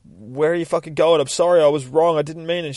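lightly voiced husband protesting as she leaves; mildly frantic husband. Husband calling after wife walking out, mock frantic apology.

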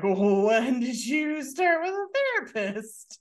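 high, loud, drawn-out startled question; taken aback. Retold or imitated. Woman asking about his new therapist, taken aback. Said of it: "she was kinda taken aback by that".

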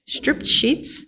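high-pitched startled question; exclaiming. A narrator's reading. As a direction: echo it back in disbelief, pitched right up. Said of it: "exclaimed Missus Rogers".

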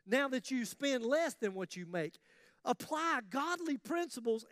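usual speaking voice

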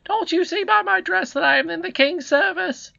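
very high, loud, indignant scold; scolding housekeeper. Acted. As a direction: berate the boy in a high-pitched character voice. Voices housekeeper in story.

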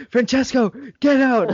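high, loud order out of the room; teacher throws the cheeky student out, loud high yell; angry teacher yell. Retold or imitated. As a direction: bark him out of class like a fed-up teacher. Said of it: "she's like".

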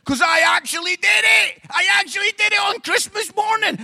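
real frustration bursting out; exasperated shout. Real, not acted. Telling the church about Christmas morning, exasperated shout.

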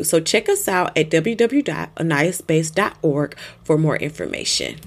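podcast ad read. Acted. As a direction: read the sponsor spot evenly. Sponsor ad read.

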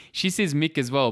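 ordinary talk, nothing marked

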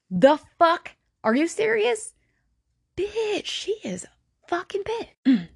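high, incredulous outburst, then a slow, drawn-out curse; real shock, voice shooting up; outraged disbelief. Real, not acted. Outraged reaction to mother's request.

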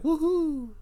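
very high sarcastic woohoo; mock cheer. Mocking the episode's moral, fake cheering.

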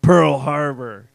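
slow, loud, deliberate; deadpan punchline. Retold or imitated. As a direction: drop it slow and heavy.

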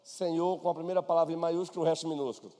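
normal talking voice

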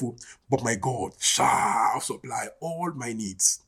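"shall" drawn out long; stress on shall. Shown as a demonstration. Preacher telling himself God will supply, stressing a long "shall". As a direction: lean on "shall" with a long, held vowel. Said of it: "I put emphasis on the shall".

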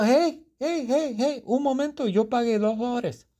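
indignant protest. Acted. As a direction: stop the clerk cold, outraged at being cheated. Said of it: "Pero yo digo".

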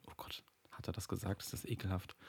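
hushed disgusted whisper; whispered aside. Real, not acted. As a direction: whisper it to yourself.

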